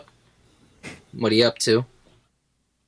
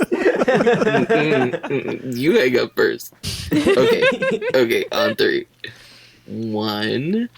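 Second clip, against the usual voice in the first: giggly late night call; 2 semitones lower, slower than usual. Acted. Giggly lovey call with partner.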